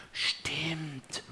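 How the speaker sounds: gedehntes, leises Zurückflüstern; flüster die Antwort, etwas höher; geflüstert. A narrator's reading. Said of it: "flüstere ich zurück". (ganz leise flüsternd)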